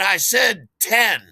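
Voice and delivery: loud, high, drawn-out insistence; slow, loud, high demand; insistent demand. Acted. Dealer telling buyer he asked for ten, loud insistence. Acted dealer disputing payment.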